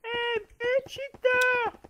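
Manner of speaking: very high squealing accusation; squeaky mock accusation. Accusing friend of cheating.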